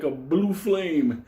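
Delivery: usual speaking voice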